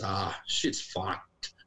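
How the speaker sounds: slow, low Australian drawl; broad Australian drawl. Retold or imitated. Sister on the phone, slow low Australian drawl. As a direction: drag it out like a weary Aussie sister. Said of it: "the most Australian thing".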